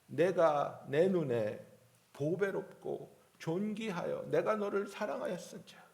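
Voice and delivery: loud, ringing Bible reading; scripture reading. Acted. Preacher reading Isaiah aloud to church, proclaiming. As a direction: proclaim God's words to the congregation.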